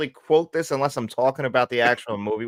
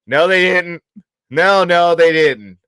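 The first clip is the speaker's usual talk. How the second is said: loud, raised-pitch comic retraction; hammed-up denial; 5 semitones higher, 8 dB louder, slower than usual. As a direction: deny it loudly, like a movie goofball. Acting out the movie quote's denial.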